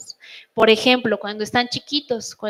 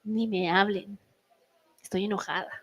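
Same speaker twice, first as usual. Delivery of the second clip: softer, seething low warning; quiet warning; 4 semitones lower, 8 dB quieter than usual. Retold or imitated. Mother warning her kids off, softer and seething. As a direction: keep them away in a hushed tone. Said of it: "así solo digo".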